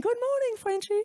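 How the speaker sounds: high cheerful imitated Canadian greeting; Canadian accent. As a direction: sing out the greeting like Alan did. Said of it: "every morning hearing".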